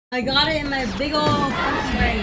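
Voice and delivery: punchy shout, big and proud; loud boast. Real, not acted. Gamer boasting about keeping track, shouted. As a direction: belt out the boast. Boast called out, then repeated calmer.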